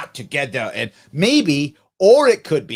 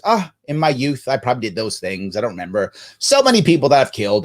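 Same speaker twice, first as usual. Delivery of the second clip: low, offhand villain boast, only lightly done; a hint of a tyrant shrugging off his crimes; host quoting the movie villain, low and offhand; lightly voiced movie villain; 6 semitones lower, faster than usual. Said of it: "And Thulsa Doom says".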